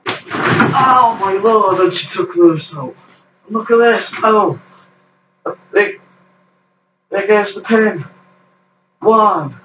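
high-pitched play-by-play with stretched exclamations; excited wrestling commentary. Pretend. Backyard wrestler calling his own match, excited play-by-play. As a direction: call the moves as they land, pitch climbing with the action.

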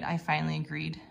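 ordinary delivery

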